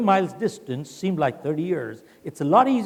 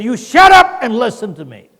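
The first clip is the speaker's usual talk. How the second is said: furious boss yelling the order; loud angry order; 4 semitones higher, 9 dB louder than usual. Retold or imitated. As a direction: shut up barked out loud. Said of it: "The shut up was very loud".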